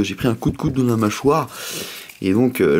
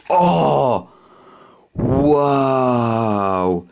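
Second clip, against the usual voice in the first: amazed. Real, not acted. Amazed by holographic card.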